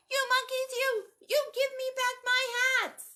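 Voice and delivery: full high-pitched yell; angry yelling. Acted. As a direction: yell furiously at the monkeys to return them. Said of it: "and he yelled".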